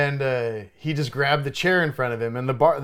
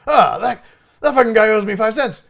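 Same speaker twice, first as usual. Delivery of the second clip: raised, louder irritated rant; indignant grumble; 9 semitones higher, 6 dB louder than usual. Retold or imitated. Bartender fuming at customer over five cents, raised irritated voice. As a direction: vent about the customer who owes you.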